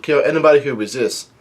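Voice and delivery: normal talking voice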